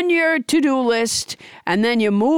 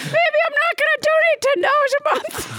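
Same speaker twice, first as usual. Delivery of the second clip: high squeaky toy voice, laughing; huffy balloon animal, high. Acted.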